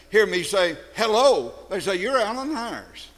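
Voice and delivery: ordinary delivery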